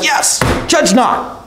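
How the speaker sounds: mock-pious, high and slow; smug agreement. Preacher mocking congregation, smugly drawn-out agreement. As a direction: play the congregation's eager self-righteous nod. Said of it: "And everybody's like".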